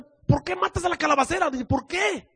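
high indignant protest; angry Jonah. Acted. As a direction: demand why in a high aggrieved voice. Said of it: "O sea".

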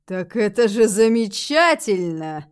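slow, loud, drawn-out approval; broad-smile delight. A narrator's reading. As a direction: draw it out loudly through a wide smile. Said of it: "широко улыбнулся".

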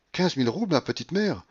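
dismayed echo. A narrator's reading. Marfa to her mistress on hearing of losses, dismayed. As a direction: take in the loss with dismay. Servant echoes the loss in dismay.

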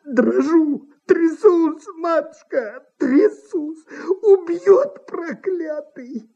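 high, weepy, terrified lament; theatrical crying, pitched high; tearful whimpering. A narrator's reading. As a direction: wail in fear like a weeping impresario. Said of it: "заплакал".

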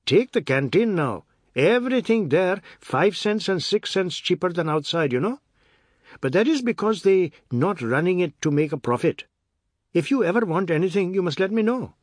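bold, high-pitched, loud brash warden; cocky Trinidadian warden bragging; brash city warden. Acted. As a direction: boast to his brother-in-law like a big shot. Ramchand's brash city talk.

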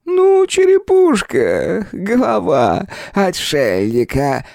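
very high, slow, cackling spirit; laughing spirit voice. Acted. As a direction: relish the joke with a chuckle. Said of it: "со смехом объяснил голос".

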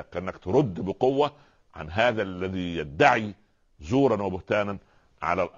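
usual speaking voice

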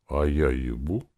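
plain reading voice

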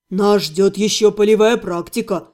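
low, matter-of-fact buddy; best friend. A narrator's reading. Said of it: "напомнил лучший друг".

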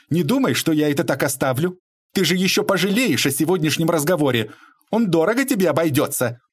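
high-pitched warning; threatening onslaught. A narrator's reading. Lady advancing on Bobrov with threats, high angry pressure. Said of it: "Она продолжала свой натиск".

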